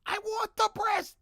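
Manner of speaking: very high, loud comic squeal; mock demanding yell. Streamer playing up his craving for viewers, mock demanding yell.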